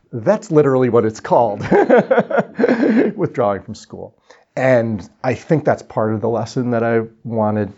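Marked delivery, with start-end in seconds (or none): 1.6-3.1 high, loud burst of laughter; real tickled cackle; amused laugh. Real, not acted. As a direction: burst out laughing at your own joke. Laughs at own story's topic match.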